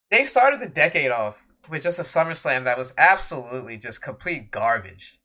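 normal talking voice

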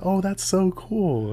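high, drawn-out imitation of polite gushing; gushing old lady. Old lady admiring his podcast job, imitated gushing.

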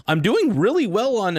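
sudden high proud burst; excited brag. Real, not acted. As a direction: pitch jumps way up on a proud boast. Bragging about podcast success.

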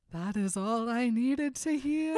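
drawn-out heartthrob line; swoony leading man. Retold or imitated. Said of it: "he goes".

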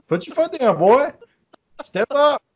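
high, teasing push to man up; mock pep talk. [name] mocking John's advice, high teasing pep talk. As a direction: egg him on in a high mocking voice. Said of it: "he was talking to me like".

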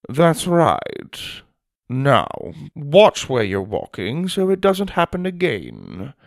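approve, then admonish him neatly; schoolmasterly headmaster. Acted. Headmaster corrects the big boy's manners, prim.

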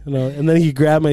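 ordinary talk

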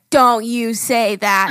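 slow, drawn-out mock warning; stretched-out pretend scolding; scolding mom. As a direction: imitate a mother telling off a child. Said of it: "then the mom was like".

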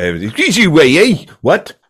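high, loud Geordie gabble; mock Geordie accent. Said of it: "the Geordie accent showed up".

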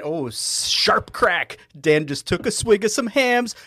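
ordinary talk, nothing marked

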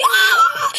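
very high mock shriek of a panicked mom; mock scream. Mom's reaction, shout heard.

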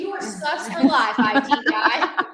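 loud teasing through laughter; laughing mock taunt. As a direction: laugh while jeering at him. Voicing coworkers mocking IT guy.

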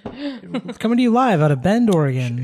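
booming radio host sign-off; announcer voice. Pretend. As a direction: announce it like a live broadcast. Mock radio sign-off with town.

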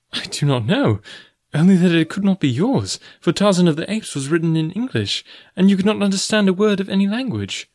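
high-pitched woman's voice; puzzled young heroine. Acted. Girl tells the forest man the note could not be his, puzzled. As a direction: reason it out in a high voice.

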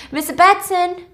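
high, loud acted call. Virgil looking for Claire in her mansion, calling out. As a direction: call through an empty house.

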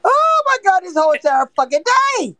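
high, loud disbelieving exclamation; excited outburst. Real, not acted. Streamer loudly reacts to the wild day of the show. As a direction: burst out in shocked delight.

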